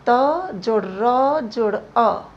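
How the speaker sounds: त जोड़ र जोड़ अ said slowly, part by part; slow spelling out. Shown as a demonstration. Teacher slowly building त्र for students. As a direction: break त जोड़ र जोड़ अ into slow parts. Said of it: "छ को देखिए".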